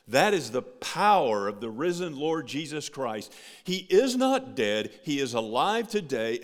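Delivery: loud, rising pulpit proclamation; fervent sermon climax; preaching at full force. Acted. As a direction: build it to a triumphant peak.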